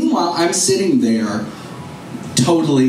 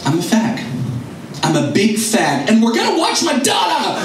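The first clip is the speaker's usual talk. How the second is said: builds from plain to a high, shouted defiance; defiant, rising to a shout at the end; defiant yell; 6 semitones higher than usual. Retold or imitated. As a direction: throw it back at mom, ending in a yell. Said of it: "and I said".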